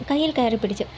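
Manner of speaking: usual speaking voice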